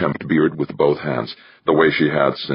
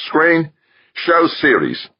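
impatient call; 13 semitones higher, slower than usual. A narrator's reading. Father impatiently calls out to the display screen. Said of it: "he impatiently called out".

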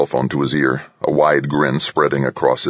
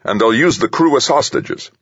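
higher, forceful objection; snapping; 8 semitones higher than usual. A narrator's reading. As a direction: insist with irritation. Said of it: "the president snapped".

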